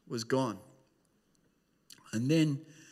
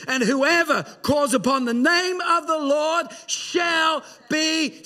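preaching that climbs higher, slow on the final word; rising preaching; 12 semitones higher, 8 dB louder, slower than usual. Acted. Pastor calling the congregation to be saved, soaring preaching. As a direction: build the call to a high, ringing peak.

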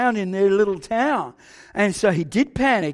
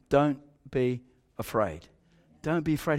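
quiet steady comfort; calm reassurance; 9 semitones lower, 9 dB quieter than usual. Retold or imitated. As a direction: settle a panicking boy gently. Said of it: "he was so calm".

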